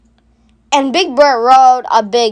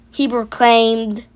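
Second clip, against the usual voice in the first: usual speaking voice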